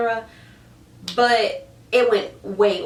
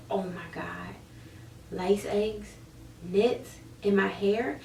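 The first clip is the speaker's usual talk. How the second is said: horrified hush; 7 dB quieter, slower than usual. Retold or imitated. Discovering lice eggs, horrified.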